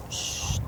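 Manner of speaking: unvoiced long "shh"; shushing. Prophet to companions, soft shush. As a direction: hush them softly.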